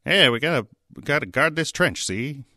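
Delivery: put-on high, loud old Hollywood gangster voice; Mid-Atlantic movie accent, clipped and nasal-free. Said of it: "that East Atlantic old Hollywood accent".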